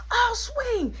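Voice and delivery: high, loud threat; retold threatening yell. Caleb threatening with golf club.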